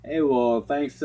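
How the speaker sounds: usual speaking voice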